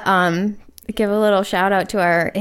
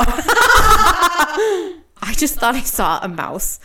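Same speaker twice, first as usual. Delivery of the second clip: high, slow, silly voice; goofy high voice; 7 semitones higher, 3 dB louder, much slower than usual. Acted. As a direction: play it dizzy and giggly. Acting a silly scene.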